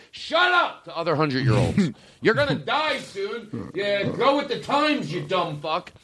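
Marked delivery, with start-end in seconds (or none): 0.1-0.8 much higher mock yell of a cranky old man. A hundred-year-old snapping at peers, high mock yell. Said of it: "I wanna be a hundred going". 2.2-5.9 high, slow put-on old man voice; cranky centenarian. Hundred-year-old needling other old people, high slow mock voice. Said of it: "I wanna be a hundred going".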